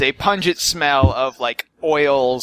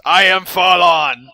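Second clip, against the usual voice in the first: booming slow giant voice, louder and higher; barbarian giant; 5 semitones higher, 8 dB louder, slower than usual. Pretend. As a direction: boom it out like a hulking giant. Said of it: "that'd be a great name for a giant".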